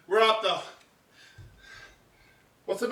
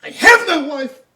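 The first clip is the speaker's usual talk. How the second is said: louder, a broken outcry; despairing cry; 4 semitones higher, 12 dB louder than usual. Acted. Othello denying he has a wife, loud despairing outcry. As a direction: wail it out in despair.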